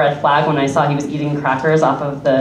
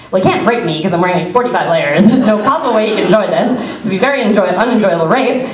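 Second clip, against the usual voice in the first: racing inner logic; 2 semitones higher than usual. Retold or imitated. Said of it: "and I'm like".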